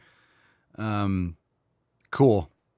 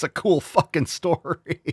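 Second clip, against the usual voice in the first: higher, slow mocking drawl; mocking sarcasm; 7 semitones higher, slower than usual. Real, not acted. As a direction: draw it out high, breaking into a laugh. Mocks the singer's speech, then laughs.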